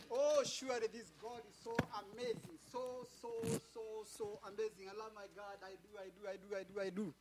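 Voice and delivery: soft, fast murmured praise; hushed devotion. Real, not acted. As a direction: drop to an intimate hush. Hushed intimate worship aside.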